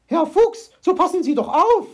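high, loud cry of alarm; aghast and yelling; horrified shout. A narrator's reading. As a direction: shout a shocked, quick reproach. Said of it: "schrie Frau Elster entsetzt".

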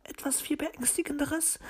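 fearful, hesitant high bird lady; halting. A narrator's reading. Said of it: "fragte sie stockend".

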